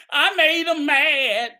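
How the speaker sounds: mock battered spouse. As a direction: mimic a victim making excuses. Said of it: "like a battered spouse".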